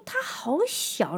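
put-on child voice. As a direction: stretch each word like an amazed child. Quoting her small son.

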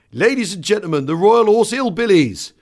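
high, loud compere's introduction; music-hall compere bellowing the act; showman announcement. Retold or imitated.

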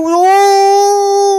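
long, high, loud "ohhh" of horror; hammed-up howl of dismay; mock outraged wail. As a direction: imitate an appalled grandmother's drawn-out cry. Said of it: "I'll never forget that reaction".

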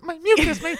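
a quick falsetto send-up; mock squeal. Friend mimicking her mucus cry, high mock squeal. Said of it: "you fell and you're like".

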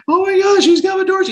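high-pitched, loud panicked squeal; mock freak-out. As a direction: imitate a horror heroine in hammed-up panic. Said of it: "Lambert is freaking out".

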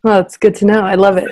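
normal talking voice

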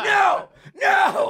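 very high squeaked refusal; mock panicked no. Retelling pushing the drunk girl away, high squeaky panic. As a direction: squeal it in comic alarm. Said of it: "And I was like".

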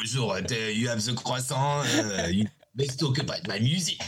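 hammed-up French accent; playful mock French accent. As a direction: imitate a Frenchman with hesitant "uh"s. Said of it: "that changed my accent".

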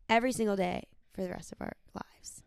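tender whisper; whispered promise. Real, not acted. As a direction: let the last promise fall to a whisper. Closing line of vows.

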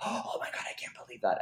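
breathless scandalized whisper; mock shocked whisper. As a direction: gasp it out like a shocked old lady. Mock shock at affair plot.

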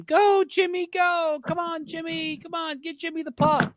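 high mock yelling hockey mom; crazy hockey mom. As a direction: shriek like a hockey mom in the stands. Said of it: "standing up and yelling the whole game".

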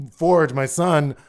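ordinary talk, nothing marked

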